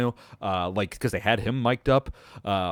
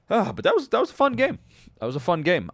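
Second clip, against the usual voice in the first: bright, much higher cheerful turn; upbeat; 8 semitones higher than usual. Real, not acted. Host turns from ranting to praising the game, upbeat. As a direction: lift the voice right up, happy.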